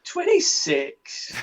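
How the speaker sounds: very high, slow squeak of a youngster; mock young claim. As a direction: pretend to be a kid claiming twenty-six. Joking about his real age.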